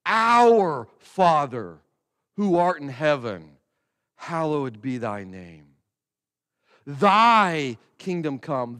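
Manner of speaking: slow prayer with key words leaned on; emphasized prayer. Shown as a demonstration. Pastor leading the congregation in prayer, slow and stressed. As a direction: pray it slowly, stressing each meaning. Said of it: "I'm placing emphasis on certain words".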